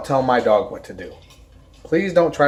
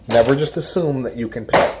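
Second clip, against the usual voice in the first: ordinary delivery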